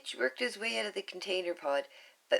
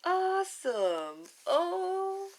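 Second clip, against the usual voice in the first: higher, slow, bright shout; read-along ad cheer; excited cheer; 12 semitones higher, 3 dB louder, much slower than usual. Acted. Cheerful ad tag.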